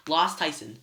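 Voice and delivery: usual speaking voice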